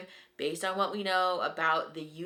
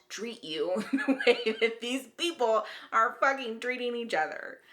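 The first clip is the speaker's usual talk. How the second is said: laughing; 4 semitones higher than usual. Real, not acted. Laughs at the couple.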